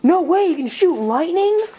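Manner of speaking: high, astonished cartoon disbelief; amazed high voice. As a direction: gasp it out amazed. Amazed kitten.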